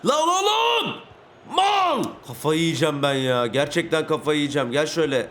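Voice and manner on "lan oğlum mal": high, angry shout at a teammate; furious yell. Real, not acted. Gamer yelling at his teammate's miss, furious high shout. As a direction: scream at the blown chance, much higher.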